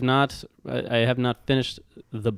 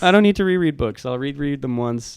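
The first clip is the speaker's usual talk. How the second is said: dismissive; 5 semitones higher, 6 dB louder than usual. Retold or imitated. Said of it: "I'm kind of like".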